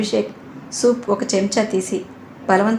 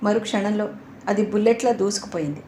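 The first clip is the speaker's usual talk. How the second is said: ordinary delivery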